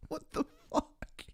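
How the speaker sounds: breathless, laughing disbelief up high. Real, not acted. Streamer cracking up at the Hulu clip, laughing disbelief. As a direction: gasp it out through laughter.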